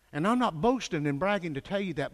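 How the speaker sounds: ordinary delivery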